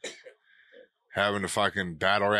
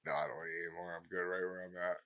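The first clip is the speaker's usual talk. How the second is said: barely audible, mumbled; very quiet; 4 semitones lower, 13 dB quieter, much faster than usual. Real, not acted. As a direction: mumble it half asleep. Quiet stoned reply.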